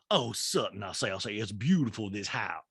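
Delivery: lightly voiced catchphrase-dropping socialite; a hint of a mannered hostess impersonation; mistaken Sutton impression. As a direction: slip into the wrong character's voice, fairly soft. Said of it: "No, sorry, sorry, Rinna says".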